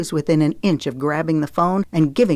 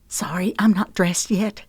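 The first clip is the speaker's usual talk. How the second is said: whispered lament; 3 semitones higher than usual. Acted. Zoe whispers an apology to Briggs about her clothes. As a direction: whisper it, a bit sheepish. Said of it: "she lamented".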